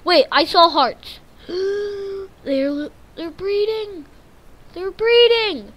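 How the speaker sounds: high, loud excited gasp; high excited discovery; excited surprise. Real, not acted. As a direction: gasp it out in delight. Villagers breeding surprise.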